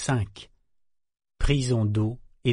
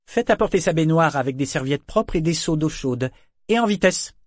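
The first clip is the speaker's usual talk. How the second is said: sharp order rising to a loud snap; commanding; 6 semitones higher, 4 dB louder than usual. Acted. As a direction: bark the orders, faster at the end. Orders sailor to fetch bath.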